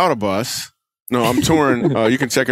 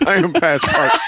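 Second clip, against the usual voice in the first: laughing; 5 semitones higher, slower than usual. Real, not acted. Cracking up at a joke.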